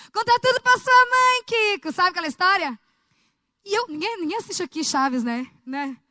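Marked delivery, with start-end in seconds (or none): 0.1-1.9 high, loud scolding TV mom; Dona Florinda impression. Quoting Dona Florinda to Kiko, high loud scolding mother voice. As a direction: belt it out like a nagging sitcom mother. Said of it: "sabe a história lá da Dona Florinda?".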